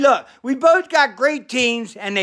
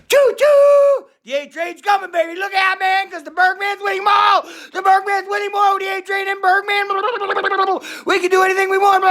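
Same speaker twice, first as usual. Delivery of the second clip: hype shout; 11 semitones higher, faster than usual. Acted. Bergman hyping the A-Train and himself, shouting. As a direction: blast the whistle and rally the crowd.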